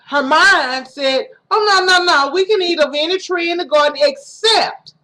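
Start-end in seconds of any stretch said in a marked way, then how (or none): 1.5-4.9 high, loud acted objection; raised-pitch correction, hanging on "except"; emphatic protest. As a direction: cut in to set the record straight. Voicing Eve's protest to Satan.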